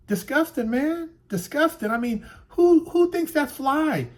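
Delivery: high, loud disgusted rant at the mess; disgusted outburst. Real, not acted. Filming his street, disgusted and shouting. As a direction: spit out disgust at what he sees.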